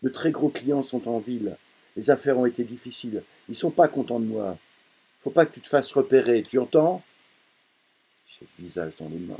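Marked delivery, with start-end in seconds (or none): 0.0-7.0 loud, higher, pressing father; father warns son, louder and higher; urgent warning. Acted.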